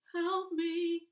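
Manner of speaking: high, loud cry for help; sick boy screaming for help, high and loud. A narrator's reading. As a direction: scream it out in pain. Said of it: "screams Paul".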